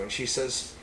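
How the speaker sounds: usual speaking voice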